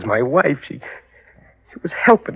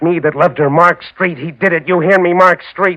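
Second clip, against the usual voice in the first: louder and higher, calling out; vengeful call; 5 semitones higher, 4 dB louder than usual. Acted. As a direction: call the killer out. Calling out to the killer.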